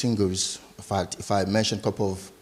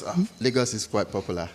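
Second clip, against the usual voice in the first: normal talking voice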